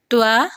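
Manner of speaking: توا said with a light ta; ta mistake. Shown as a demonstration. Teacher showing the light ta error. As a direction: say it wrongly as توا. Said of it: "یا پھر ہم تو کو".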